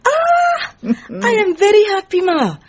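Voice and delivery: secretary squealing at engagement news; delighted cry. Acted. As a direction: burst out with happy surprise.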